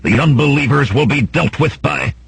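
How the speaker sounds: high, declamatory threat at moderate volume; crying out. A narrator's reading. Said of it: "the red-robed man cried".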